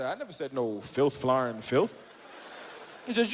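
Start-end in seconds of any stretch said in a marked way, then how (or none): 0.0-1.9 much lower put-on voice; innocent denial. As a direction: play dumb in a deep voice.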